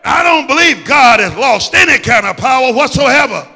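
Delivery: loud, driving sermon voice; full-force preaching. Acted. Preacher rallying the congregation, preaching full force. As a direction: preach it hard over the music.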